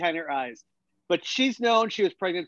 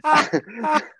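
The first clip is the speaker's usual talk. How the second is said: high, loud cackling laugh; sudden loud laugh; real burst of laughter; 10 semitones higher, 5 dB louder than usual. Real, not acted. Laughs at the absurd scene.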